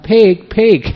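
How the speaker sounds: high, loud yell of warning; a farmer yelling a warning to the speeding driver; warning shout. Retold or imitated. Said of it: "That's why he's calling out".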